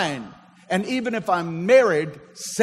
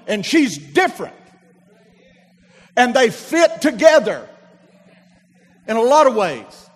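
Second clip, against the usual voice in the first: loud, high, rising sermon climax; full-force preaching; 6 semitones higher, 8 dB louder than usual. Acted. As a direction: drive it home to the pews.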